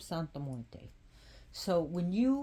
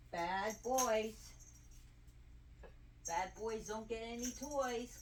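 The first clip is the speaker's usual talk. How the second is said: sing-song scolding; 4 semitones higher, 9 dB quieter than usual. Real, not acted. Mock-scolds the cats.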